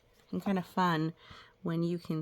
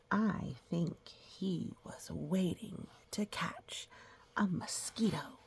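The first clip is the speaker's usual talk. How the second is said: slow soft whispered aside; hushed storyteller aside; 6 dB quieter, slower than usual. Real, not acted. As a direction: whisper it like a secret. Whispered aside while reading.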